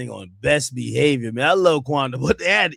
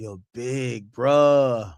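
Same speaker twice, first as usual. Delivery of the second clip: very slow, stretched Savannah drawl; 2 semitones lower, much slower than usual. Retold or imitated. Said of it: "It was like".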